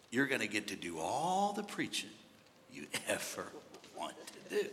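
soft, amused, a laugh breaking in. Retold or imitated. God teasing the young preacher, softly laughing. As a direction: say it with a quiet chuckle. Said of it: "kind of in a laughing tone".

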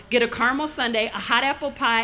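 ordinary talk, nothing marked

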